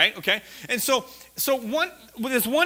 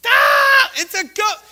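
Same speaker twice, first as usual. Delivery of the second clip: high, loud panicked cry; terrified shout; 14 semitones higher, 9 dB louder, slower than usual. Acted. The disciples in the storm shouting in terror. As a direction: scream it like you have seen a ghost.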